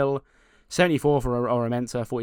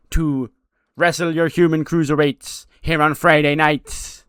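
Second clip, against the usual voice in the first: Danhausen voice; 3 semitones higher, 10 dB louder than usual. Retold or imitated. Danhausen greeting the Velocity crowd, loud and theatrical. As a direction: ham it up as the creepy comedic wrestler.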